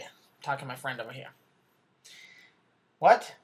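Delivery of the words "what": startled what. Acted. Anchor answers off-camera remark.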